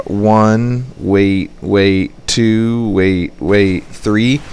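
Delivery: slow count with pauses between one, two, three. Shown as a demonstration. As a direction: count it out slowly, pausing on each wait. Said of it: "if you stutter step ... and you go".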